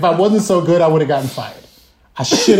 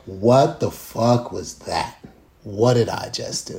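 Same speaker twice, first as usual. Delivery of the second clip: mild dismay; 7 semitones lower, 5 dB quieter than usual. Retold or imitated. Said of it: "And I'm just like".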